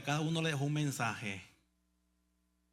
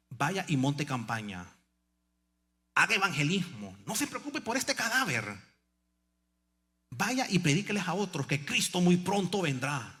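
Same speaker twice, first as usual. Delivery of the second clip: put-on louder, insistent old sister; the sister sending the pastor off to preach, louder; sister urging; 3 semitones higher, 5 dB louder than usual. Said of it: "Tome, pastor".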